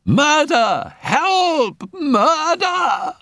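very high, loud cry of agony; agonized scream. A narrator's reading. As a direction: scream for help in sheer agony. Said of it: "high and agonized".

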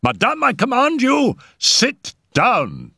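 high, urgent order; alarmed command. A narrator's reading. Raoul orders the mother to sit, urgent command. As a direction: command with pitch leaping up in alarm.

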